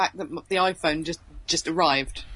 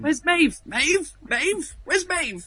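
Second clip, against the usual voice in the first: calling out; 9 semitones higher, slower than usual. Retold or imitated. Searching for missing Maeve.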